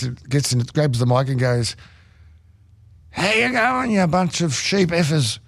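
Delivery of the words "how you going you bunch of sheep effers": put-on much higher, slow, sloppy drunken holler; mock drunk frontman winding up the Kiwi crowd; drunk rocker greeting. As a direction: taunt the crowd like a plastered rock singer.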